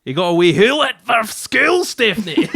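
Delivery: high, loud hammed-up Scots cry; Scottish accent. Husband explaining the owl to his wife, high mock-Scottish shout. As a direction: shout it in over-the-top Scottish. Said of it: "putting on the Scottish accent".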